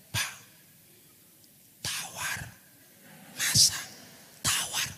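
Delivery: voiceless mock whisper; hushed aside. Pastor discreetly telling village head the wine is tasteless, whispered. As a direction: whisper it secretly to the man beside you.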